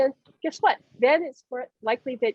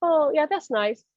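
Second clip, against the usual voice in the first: mock unimpressed; 6 semitones higher than usual. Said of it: "listened to the music and said".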